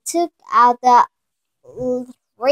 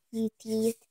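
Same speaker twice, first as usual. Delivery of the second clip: ordinary delivery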